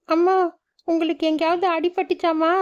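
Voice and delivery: put-on a little girl checking if her mother is hurt; worried child Meenu. Daughter asking her mother if she is hurt, worried child voice.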